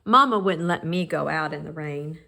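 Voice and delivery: plain reading voice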